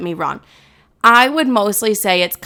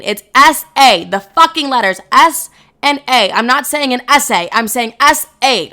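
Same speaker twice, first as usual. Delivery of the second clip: real annoyed emphasis; 4 semitones higher, 6 dB louder than usual. Real, not acted. Host fed up with DMs asking about S A, angry and emphatic. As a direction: hammer the letters home, fed up. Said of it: "I don't know why I'm getting so mad".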